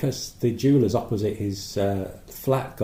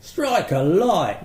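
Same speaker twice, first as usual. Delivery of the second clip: broad cockney "Strike a light!"; old London cockney cry, slow and drawn; cockney exclamation; 5 semitones higher, much slower than usual. Pretend. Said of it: "nobody's ever said that".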